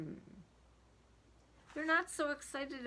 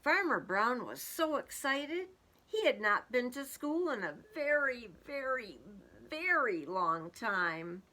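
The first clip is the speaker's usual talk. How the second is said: lively storybook narration; reading to children; 3 semitones higher, 3 dB louder than usual. A narrator's reading. Teacher reading a picture book aloud to kids. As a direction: read it out to the class with a bounce.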